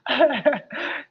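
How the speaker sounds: soft, short chuckle; real laugh. Real, not acted. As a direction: chuckle quietly to yourself. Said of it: "I'm always laughing".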